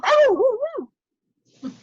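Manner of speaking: high loud dog yelp; yelping dog cry. Acted. Greyhound struck by the stone, high loud yelp.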